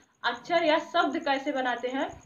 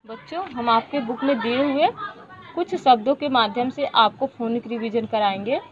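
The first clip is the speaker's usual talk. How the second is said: put-on louder, slow sounding out for children; classroom phonics drill; 3 semitones lower, 10 dB louder, slower than usual. Shown as a demonstration. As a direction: call out each sound clearly to the class. Teacher leads phonics revision drill.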